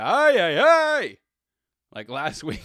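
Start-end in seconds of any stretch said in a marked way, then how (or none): 0.0-1.2 rising, much louder and higher wail; hammed-up commentator groaning at a play; excited Spanish broadcaster yell; Spanish announcer cry. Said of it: "Sometimes you'll get a".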